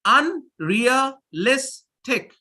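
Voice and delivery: Unrealistic said very slowly, un-re-a-lis-tic beat by beat; slow syllable demo. Shown as a demonstration. Teacher counting syllables in unrealistic aloud, very slow. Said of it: "How many?".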